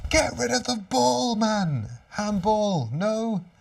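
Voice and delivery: high, loud shout to clear the ball; panicked fan yelling at the scramble; frantic yell. Real, not acted. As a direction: bark at the defenders through the screen. Fan yelling during goalmouth scramble.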